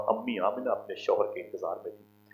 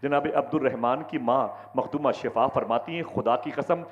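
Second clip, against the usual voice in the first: full-force preaching; 4 semitones higher than usual. Acted. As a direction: proclaim it to the whole crowd. (ज़ोरदार तक़रीर)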